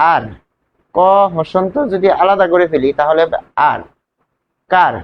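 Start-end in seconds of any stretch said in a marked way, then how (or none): none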